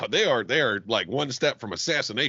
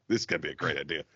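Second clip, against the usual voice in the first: low, softer ironic remark; wry aside; 9 semitones lower, 6 dB quieter, faster than usual. Retold or imitated. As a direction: drop it low as a doomed prediction. Wry retold thought about misogynist guest.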